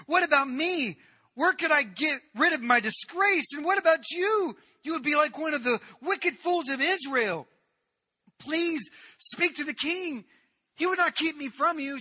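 very high, loud, breaking with desperation; frantic pleading. Acted. Tamar tries to reason with Amnon, desperate high pleading. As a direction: reason with him as if your life depends on it.

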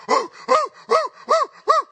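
high, loud mock hooting; playful high woo woo woo of a startled animal; possum hoots. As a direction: imitate the possum springing back to life. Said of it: "that thing pops up and goes".

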